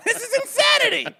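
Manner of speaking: high mock outrage; comically indignant, very high; man on the street. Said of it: "I'm the man on the street".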